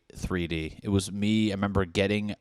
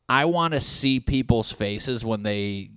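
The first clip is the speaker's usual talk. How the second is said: ordinary delivery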